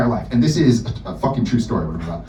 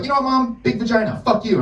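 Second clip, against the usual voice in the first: panicked blurt; 11 semitones higher than usual. Retold or imitated. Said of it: "so I panicked".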